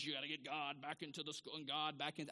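mock zealot. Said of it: "one of those".

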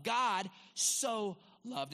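slow, emphatic "so", stretched out long; stress on SO. Real, not acted. Preacher sums up extravagant love, long emphatic "so". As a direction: draw out "so" as the climax.

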